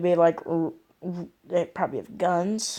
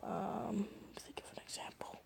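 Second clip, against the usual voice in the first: barely audible whisper to oneself; thinking aloud; 3 semitones higher, 20 dB quieter than usual. Real, not acted. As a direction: murmur it under your breath while searching for an idea. Muttering to self while thinking.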